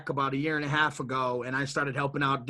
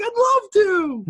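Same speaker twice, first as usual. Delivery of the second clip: slow, high, louder gush of joy; delighted yes; 7 dB louder, slower than usual. Retold or imitated. He accepts the owner's offer, slow and overjoyed. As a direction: savor the yes, lifted and beaming. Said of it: "And I was like".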